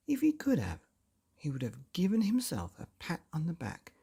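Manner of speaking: usual speaking voice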